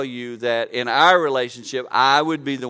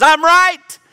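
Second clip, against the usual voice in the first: much higher, much louder mocking cry of a wife insisting she's right; mock shrill wife; 15 dB louder than usual. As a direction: shriek it like a caricature of a stubborn wife.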